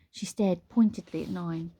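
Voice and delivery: ordinary delivery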